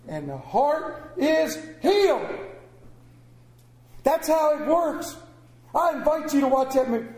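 loud, high fervent preaching; full-voiced revival preacher; preaching at full force. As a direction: lift the room with it. Preaching climax.

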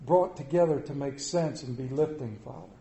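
soft, hushed prayer voice. Acted. Pastor praying over the church, hushed and reverent. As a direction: lead the congregation in quiet prayer. Opening prayer before sermon.

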